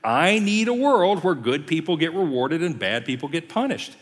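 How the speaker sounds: high-pitched, indignant imitation; mock angry Elihu. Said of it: "Elihu just gets mad at him".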